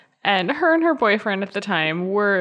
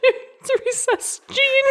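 laughing; slower than usual. Real, not acted. High slow joke line.

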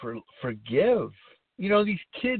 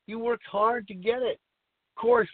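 normal talking voice

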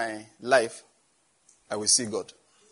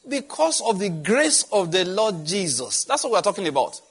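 preaching proclamation; 6 semitones higher, 6 dB louder than usual. Acted. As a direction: lift it and draw it out like a pulpit climax.

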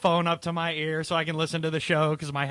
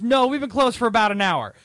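loud retold retort; 6 semitones higher, 5 dB louder than usual. Retelling the manager's retort.

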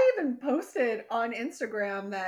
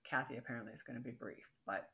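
fast, low, much softer aside; quick low teasing aside to the co-host; mutter aside; 8 semitones lower, 10 dB quieter, faster than usual. Real, not acted. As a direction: mutter it under your breath. Joking aside under breath.

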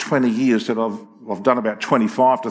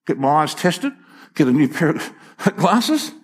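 ordinary delivery

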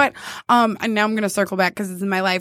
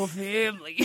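low, soft movie tough-guy impression; hushed, hammed-up action-hero impression; Vin Diesel; 4 semitones lower, 10 dB quieter than usual. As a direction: drop the voice like an action star. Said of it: "My Vin Diesel impression is".

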